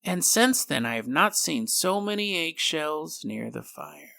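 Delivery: put-on slow, soft, eerie voice of an ancient thing in a baby; creepy baby voice. Changeling baby speaks to its startled mother, slow, soft and eerie. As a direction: let the changeling speak, slow and hushed, like something very old. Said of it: "she hears her little baby go".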